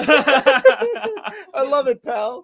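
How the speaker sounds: high, loud, drawn-out cutesy voice; mock gushing reply. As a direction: stretch it out high and giggly. Joking reply in same dog voice.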